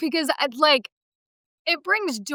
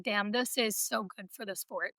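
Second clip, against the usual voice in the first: quiet awe; 5 semitones lower, 13 dB quieter than usual. Retold or imitated. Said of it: "I was like".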